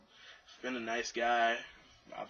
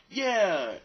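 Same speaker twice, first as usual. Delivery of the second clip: eager yeah; 7 semitones higher, 6 dB louder than usual. Retold or imitated. Eager to catch.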